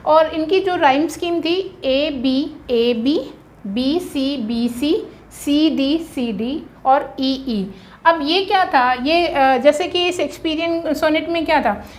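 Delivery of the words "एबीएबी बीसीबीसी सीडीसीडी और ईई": 1 slow dictation. Shown as a demonstration. Teacher dictates rhyme scheme.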